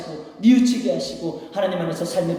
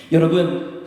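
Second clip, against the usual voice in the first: ordinary talk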